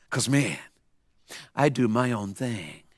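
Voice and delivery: slow, low, very soft drawl; imitating laid-back kid. Mohawked kid explains himself to preacher, slow drawling imitation. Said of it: "He goes like this".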